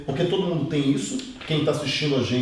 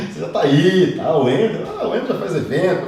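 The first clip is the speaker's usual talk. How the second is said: louder, higher quote broken up by amusement; laughing mock quote; 4 semitones higher, 5 dB louder than usual. Mimicking someone who says success is easy for you, laughing. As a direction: imitate a dismissive onlooker, laughing through it. Laughing while voicing detractors.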